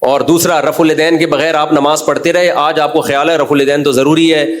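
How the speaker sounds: ordinary talk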